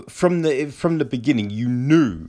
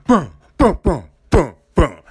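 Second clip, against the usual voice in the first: mock the dramatic ending sound; imitated booming beats; 2 semitones higher, 6 dB louder than usual. Mimicking dramatic ending music.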